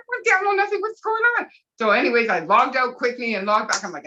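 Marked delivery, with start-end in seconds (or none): 0.1-1.5 very high, flustered squeal; mock panic. User retelling a surprise download prompt, high mock panic. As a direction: re-enact a frantic, baffled protest.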